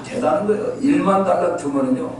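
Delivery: ordinary talk, nothing marked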